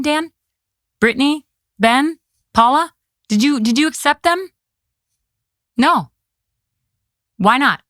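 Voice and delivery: high, pressing questions with anger held in; mad but controlled. Retold or imitated. Commissioner challenging panel over rejected witnesses, controlled anger. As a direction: demand an answer, keeping your cool. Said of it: "I got mad. But I kept my cool".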